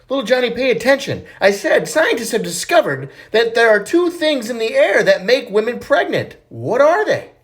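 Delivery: mildly impatient, repeating herself; lightly stern teacher. Acted. Teacher scolds Johnny for not listening, mildly stern.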